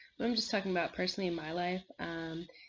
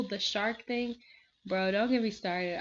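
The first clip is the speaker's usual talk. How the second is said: ordinary talk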